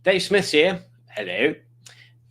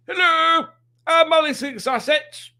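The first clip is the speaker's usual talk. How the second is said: very high, louder, slowed-down put-on voice; Alex's voice; 5 dB louder, slower than usual. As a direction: squeak the greeting high and slow. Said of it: "that's how she talks".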